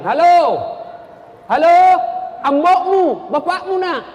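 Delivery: put-on loud, high hallo of an old villager; shouting into phone. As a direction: holler down the line as if it barely works.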